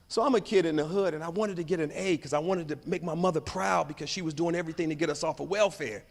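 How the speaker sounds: ordinary delivery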